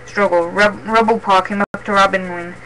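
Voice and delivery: plain reading voice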